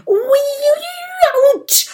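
high, loud drawn-out ouch; pained yelping. Acted. Dragon pricked by the vampire, yelping in pain. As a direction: yelp as the needle goes in.

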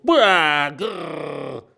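loud, high playful monster growl Buah grrr; a child playing vampire, roaring to scare. A narrator's reading. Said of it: "steckt es in den Mund und ruft". (lautes, verspieltes Monsterbrüllen)

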